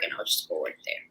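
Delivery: whispered to herself, fast. Retold or imitated. Plotting to herself about the boyfriend's restaurant, whispered. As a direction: let the plan slip out in a whisper, quickly. Repeats her plan as a secret.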